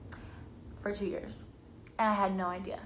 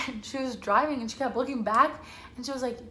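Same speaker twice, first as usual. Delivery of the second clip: normal talking voice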